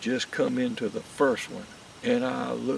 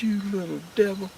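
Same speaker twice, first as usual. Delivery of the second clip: mock scolding; 10 semitones higher than usual. Cursing the squirrel, retold.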